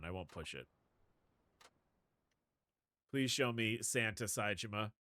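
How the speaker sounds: ordinary talk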